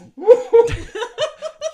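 very high, loud squealing laugh; burst of laughter. Real, not acted. Host cracking up retelling the choking, squealing laugh. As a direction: shriek with laughter.

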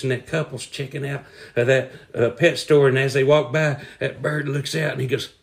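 ordinary talk, nothing marked

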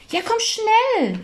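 put-on a child hurrying his sister along; Paul calling out high and slow; eager boy calling. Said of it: "rief Paul".

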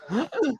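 sharp high gasp for air; choking gasp. Retold or imitated. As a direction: gasp like something's stuck. Retelling choking on steak.

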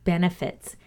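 ordinary delivery

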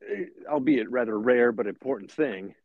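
normal talking voice

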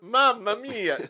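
slow, high, loud mock Italian exclamation; Italian accent. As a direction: ham up an Italian Mamma mia. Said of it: "They're an Italian family".

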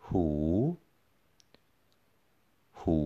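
หู with a rising tone, carefully. Shown as a demonstration. Teacher modelling หู for students, rising tone. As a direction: let หู climb in pitch. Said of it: "with a rising tone".

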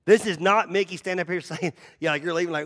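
ordinary talk

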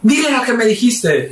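high, slow, insistent order; mock outraged mother demanding a confession; mother's demand. Mother makes son repeat it to father, high insistent demand.

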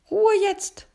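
shrill high loud order; commanding. A narrator's reading. Nightingale silences the chattering animals, loud and high. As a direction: call the choir to silence. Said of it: "kommandierte die Nachtigall".

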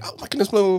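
high, excited exclamation. Retold or imitated. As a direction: gush with surprise. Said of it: "I was like".

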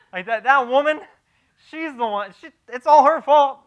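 hammed-up Adam blaming the woman; loud mocking finger-pointing, rising to a shout; loud whiny blame. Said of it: "What does Adam say?".

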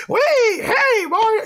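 very high squeaky mushroom voice; squeaky Toad voice. Retold or imitated. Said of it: "that squeaky".

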